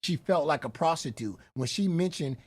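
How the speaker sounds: usual speaking voice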